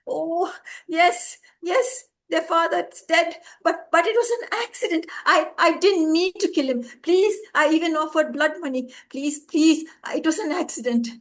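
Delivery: very high, loud stammering plea; frantic pleading. A narrator's reading. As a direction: plead desperately. Driver pleads before judge.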